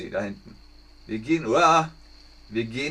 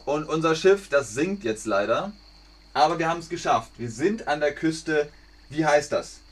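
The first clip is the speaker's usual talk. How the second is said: usual speaking voice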